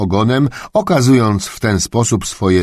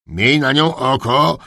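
raised, higher voice of a master; thundering duke; 7 semitones higher, slower than usual. Acted. Duke warning Heinz to watch the dog, booming. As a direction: boom the order at a servant. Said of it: "zagrzmiał książę".

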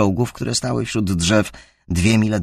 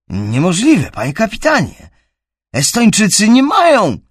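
high, loud disbelieving shout; urgent protest; 11 semitones higher, 7 dB louder than usual. A narrator's reading. Driver protests over radio.

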